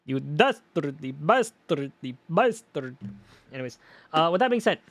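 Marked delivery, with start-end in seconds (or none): 0.0-3.0 slow, hammed-up Transylvanian accent. Shown as a demonstration. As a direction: roll out each insult in a Transylvanian accent. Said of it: "sounds better in that voice".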